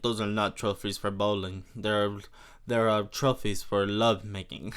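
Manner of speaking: low, put-on French accent; hammed-up French seducer boasting. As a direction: do a playful mock French lover. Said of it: "it's just such a fun accent to do".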